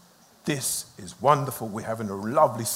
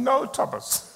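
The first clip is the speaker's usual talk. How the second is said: higher, put-on voice of a reluctant patient; mock protest; 12 semitones higher, slower than usual. Pastor retelling his protest to Thomas, in a mock high voice. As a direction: play your own reluctant younger self. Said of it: "When I was thinking".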